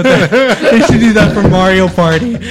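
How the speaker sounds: laughing high and slow. Real, not acted. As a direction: talk through a big laugh. Laughing hard.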